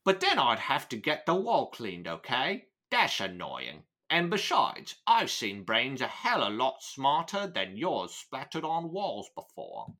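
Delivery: put-on Cockney gangster; Cockney accent. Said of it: "my ability to do a Cockney British accent".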